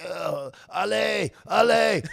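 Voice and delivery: loud, high, drawn-out French shouts; imitated yelling. Russian legionnaire yelling at the slow runner, urging shout. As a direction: holler it at a straggler, slowly stretched. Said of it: "getting yelled at in the back".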